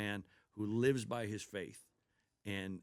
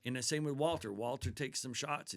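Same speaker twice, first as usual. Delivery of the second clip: normal talking voice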